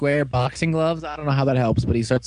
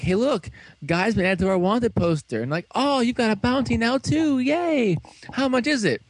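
high-pitched, chirpy crew chatter; mock excitement; 9 semitones higher than usual. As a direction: act out friends excited at the news. Crew excited over wanted poster.

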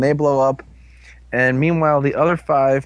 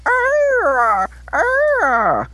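high, drawn-out imitation dinosaur roar; Tyranno roar. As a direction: screech like a mock tyrannosaur. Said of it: "that's a Tyranno noise".

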